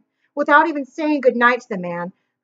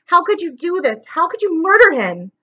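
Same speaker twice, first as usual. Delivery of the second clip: anguished accusation; 6 semitones higher than usual. A narrator's reading. Klaus accuses the killer.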